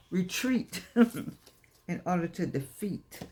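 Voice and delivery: drawn-out slogan with a laugh; slow proclamation with a chuckle. Acted. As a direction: lean on each word, slow. Slogan delivered slowly, laughing.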